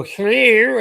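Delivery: a high, loud rising "Hello."; mock scammer hello. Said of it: "They go".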